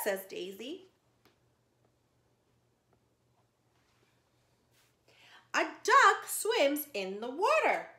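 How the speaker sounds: lively storybook read-aloud for little ones; reading to children. Acted. Teacher reading a zoo story to children, lively storytime voice.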